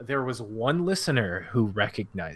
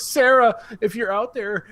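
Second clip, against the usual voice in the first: high-pitched call to someone missing; mock public appeal; 10 semitones higher, slower than usual. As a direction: call out hopefully, hammed-up. Mock public appeal to lost listener.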